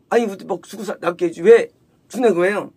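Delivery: ordinary talk, nothing marked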